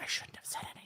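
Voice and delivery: whispered self-reproach. Retold or imitated. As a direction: whisper it to yourself. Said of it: "and I was like".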